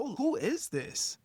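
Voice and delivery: high, soft, drawn-out wondering; mock astonished thought to oneself; taken aback. Acting out his startled thought.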